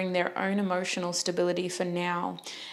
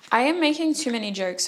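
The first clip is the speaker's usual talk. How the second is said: usual speaking voice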